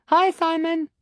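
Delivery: high, thin squeak; squeaky little voice. A narrator's reading. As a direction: squeak it like a tiny girl. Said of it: "in her squeaky little voice".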